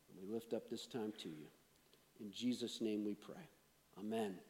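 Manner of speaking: low, soft, reverent prayer; praying. Real, not acted. Pastor lifting up this time to God, soft prayer. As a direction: pray quietly, with measured pauses.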